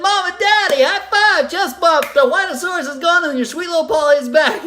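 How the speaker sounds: put-on high, loud bouncy child; cheerful triumph. Little girl telling her parents the tantrum is over, gleeful. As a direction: announce it with bright glee.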